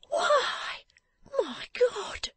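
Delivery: high, breath-thin gasp of shock; horrified, thin high exclamation; shocked recoil. Acted. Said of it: "she said, recoiling".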